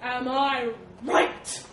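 very slow, loud threat; menacing demand. A narrator's reading. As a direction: demand agreement, stretching every word. Elephant threatens pinned boar.

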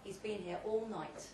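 ordinary delivery

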